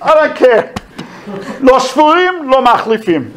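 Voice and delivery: high, dismissive clerk's voice; bored supply clerk. Retold or imitated. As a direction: brush off the soldier from behind the counter. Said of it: "He says".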